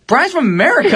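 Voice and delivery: high-pitched retold protest; exposing the prank. Friend giving away the fake accent, high protest. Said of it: "He goes".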